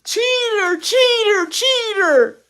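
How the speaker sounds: high, loud, accusing shriek; shouting. A narrator's reading. Mother kicking at the locked door, shouting. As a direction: shout each word as an accusation. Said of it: "kicked at the door and shouted".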